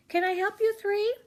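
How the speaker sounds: high, loud, chirpy clerk voice; friendly shop clerk. Acted. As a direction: greet the kids like an eager store teen. Teenage shop clerk greets them.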